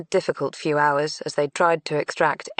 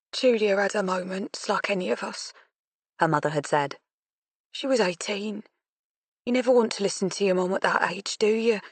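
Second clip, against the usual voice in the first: put-on Yorkshire accent; 4 semitones higher than usual. Grieving mother in Yorkshire voice.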